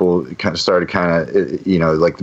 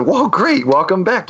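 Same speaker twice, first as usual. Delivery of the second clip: high, cheery welcome back; excited welcome; 10 semitones higher than usual. Retold or imitated. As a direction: greet him like a long-lost friend. Greeting the returning pizza guy.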